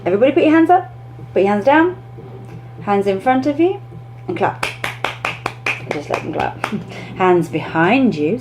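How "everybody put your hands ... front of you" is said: high bright teacher instructions; upbeat teacher giving actions; classroom commands. Shown as a demonstration. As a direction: call the kids' moves out cheerfully. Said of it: "another way of doing that is".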